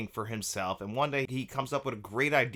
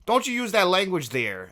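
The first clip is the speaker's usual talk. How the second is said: put-on higher, louder Minnesota accent; imitated Minnesota accent, pitched up; 7 semitones higher, 5 dB louder than usual. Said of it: "nails down that Minnesota accent".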